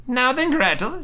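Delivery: high-pitched witch summons; witch crying out. Acted. Witch summoning Gretel to work, high cry. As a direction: call the girl sharply in a high witch voice. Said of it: "she cried to the girl".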